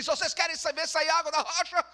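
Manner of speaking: high, loud furious shout; losing control. Acted. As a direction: blow up at the crowd. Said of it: "Ele ficou bravo e perdeu o controle".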